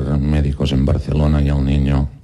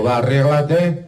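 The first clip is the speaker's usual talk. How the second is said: playful call to duck; 7 semitones higher than usual. Acted. Man talks to duck.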